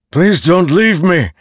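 loud, high-pitched plea; desperate cry. A narrator's reading. Dalinar calls after the departing voice, desperate. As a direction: cry out after someone vanishing.

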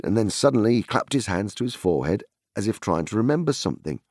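ordinary delivery, nothing marked

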